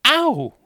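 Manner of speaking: sudden high, loud "ow"; sharp cry of pain; pained yelp. Acted. Lizzie yelps as Enoch hurts her wound, high and loud.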